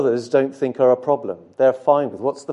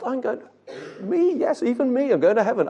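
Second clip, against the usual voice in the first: amazed joy; 8 semitones higher than usual. Acted. Believer marvels at being saved.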